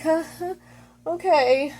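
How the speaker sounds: high, uneasy huh-huh into okay; imitated nervous laugh. She is freaked out by his joke, mock nervous laugh. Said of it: "she's, like, freaking out".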